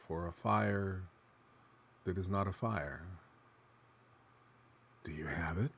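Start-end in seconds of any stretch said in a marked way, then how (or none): 5.0-5.7 hushed, urgent whisper of a plea; desperate whisper. Acted. Asylum inmate begs the narrator for it, desperate whisper. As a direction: breathe it out desperately, no voice. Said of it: "he asked desperately".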